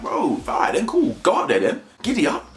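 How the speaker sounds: dismissive bravado. Retold or imitated. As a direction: brush him off with a cocky dare. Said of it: "It's like".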